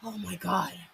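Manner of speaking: low, soft, weary sigh of disbelief; exasperated. Retold or imitated. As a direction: mutter it in disbelief. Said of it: "I have to be like".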